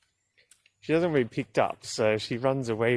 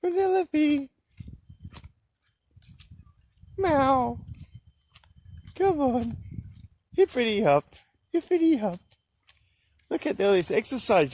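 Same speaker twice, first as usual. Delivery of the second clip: high calling voice; 14 semitones higher than usual. Real, not acted. Calls her cat Penelope home in a high voice.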